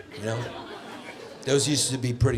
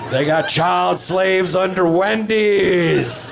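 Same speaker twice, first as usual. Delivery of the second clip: slow, higher and loud; mock conspiracy nut; 6 semitones higher, 5 dB louder, much slower than usual. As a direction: announce it like a revelation. Conspiracy kid quoted.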